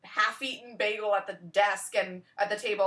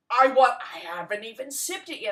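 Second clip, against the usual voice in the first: loud, outraged customer complaint; indignant customer; 4 semitones higher, 10 dB louder than usual. Retold or imitated. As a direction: bark it like a wronged customer. Angry customer whose drink was thrown out.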